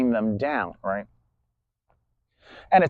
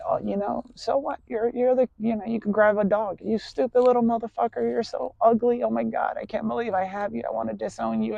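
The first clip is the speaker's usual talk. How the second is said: sweet tone, nasty words; 7 semitones higher than usual. Shown as a demonstration. Talking to a dog, nasty words in a sweet high coo. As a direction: coo the insults as if praising a pet. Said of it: "So you can say".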